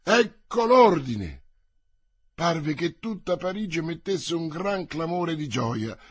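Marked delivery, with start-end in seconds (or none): 0.0-1.5 loud, high, drawn-out announcement; slow raised call; triumphant exclamation. A narrator's reading. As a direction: proclaim it to a crowd from a window. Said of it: "esclamò".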